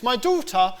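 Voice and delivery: normal talking voice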